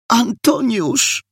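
high, drawn-out happy cry; joyful call. A narrator's reading. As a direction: call out the name in delight. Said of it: "zawołała radośnie". (radosny okrzyk)

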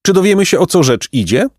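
lightly voiced high bird; high, eager pelican question. A narrator's reading. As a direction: press impatiently to hear the secret. Story figure, pelican.

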